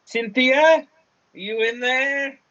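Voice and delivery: high, drawn-out call into a cave. Acted. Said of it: "and calls out".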